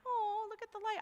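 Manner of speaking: high, lightly mocking gentle voice; mock dreamy gentle. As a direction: play it as a sweet little flicker, teasing. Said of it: "I'm not talking like".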